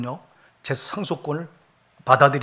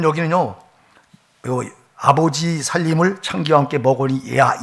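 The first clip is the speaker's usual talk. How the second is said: normal talking voice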